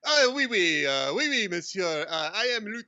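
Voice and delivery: hammed-up French accent; comic mock French accent; best French accent. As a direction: put on an exaggerated French gentleman's voice. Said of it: "put on your best French accent".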